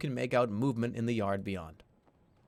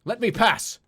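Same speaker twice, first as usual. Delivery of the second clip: higher, louder demand to pass; commanding yell; 8 semitones higher, 6 dB louder than usual. Acted. Intruder yelling at the birdmen to let him pass. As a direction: yell it so the guards freeze. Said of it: "Your tone stops them in their tracks".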